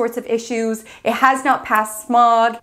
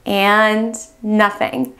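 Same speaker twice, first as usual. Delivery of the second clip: slow dead nothing; much slower than usual. Pretend. The key turns again, drawn out slowly. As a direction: stretch And nothing out slowly, deflated. The car still will not start.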